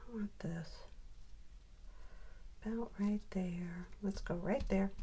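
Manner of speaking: whisper. Real, not acted. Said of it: "quiet self-talk while placing".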